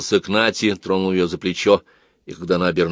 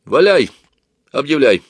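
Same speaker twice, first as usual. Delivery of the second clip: brisk go-ahead, louder and higher; бодрая команда; 6 semitones higher, 6 dB louder than usual. A narrator's reading. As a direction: give the go-ahead with energy. Разрешает объявить начало собрания. (бодро, громко)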